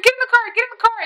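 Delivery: high, excited imitation of a friend; urgent squeal. Friend ordering her into the car, high urgent squeal. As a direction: urge it way up high, repeating.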